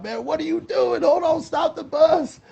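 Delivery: high, slow startled outcry; jolted awake, high and drawn out; Ricky yelling from the bunk. Retold or imitated. Tour manager woken, crying out high and slow. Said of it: "he came out".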